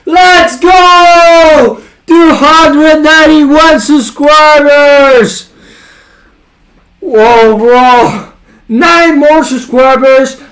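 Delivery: high, loud hyped-up shouting; gym bro. Real, not acted. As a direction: yell it like a pumped-up bro. Celebrating subscriber milestone.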